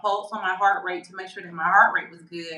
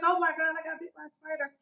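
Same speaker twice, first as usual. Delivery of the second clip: high-pitched retold panic, fading softer; mock-frantic squeak trailing away; freaking out; 7 semitones higher, 4 dB quieter, faster than usual. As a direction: replay the panic in a small high voice. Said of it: "I started freaking out".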